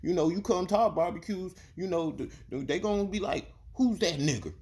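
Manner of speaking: high put-on voice of the boss's son, coaxing; mocking the boss's son. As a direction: mimic him, high and sugary. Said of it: "voicing Boss Man's son".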